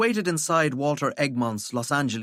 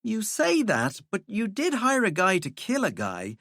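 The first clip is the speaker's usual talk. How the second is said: put-on higher, firm young woman; lightly voiced scornful young woman; 9 semitones higher than usual. Valkyrie dry retort.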